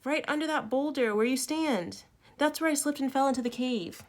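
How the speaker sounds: lightly voiced girl. A narrator's reading. Trapped girl explains how she fell, lightly voiced.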